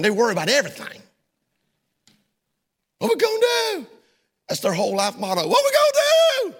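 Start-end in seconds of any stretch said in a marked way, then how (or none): 3.0-3.9 high panicky whine of a worrywart; mock the fretting worrier in a squeaky voice; anxious worrier. Pastor mocking worriers, high panicky whine. 5.5-6.5 high panicky whine of a worrywart; mock the fretting worrier in a squeaky voice; anxious worrier. Pastor mocking worriers, high panicky whine.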